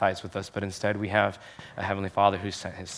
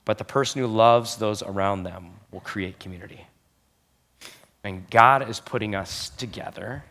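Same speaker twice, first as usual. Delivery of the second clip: normal talking voice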